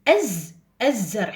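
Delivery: الزرع with the l assimilated, azzarʕ; correct reading. Shown as a demonstration. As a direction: jump straight to the z sound. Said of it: "نروح على حرف الز".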